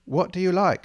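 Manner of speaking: ordinary delivery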